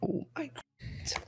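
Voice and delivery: high, whispered oh my God; breathless high gasp. Real, not acted. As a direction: squeal it out in disbelief. Groaning at a bad pun.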